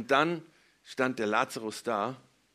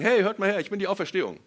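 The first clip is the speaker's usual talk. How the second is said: loud, raised mock proclamation; großspurige Ankündigung; 6 semitones higher, 7 dB louder than usual. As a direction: call for everyone's attention with a hollow boast. Spielt einen Angeber nach. (laut und großspurig ausgerufen)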